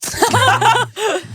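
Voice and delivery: shrieking laugh, real; high burst of laughter. Real, not acted. Laughing at the new wig joke, a high burst of laughter.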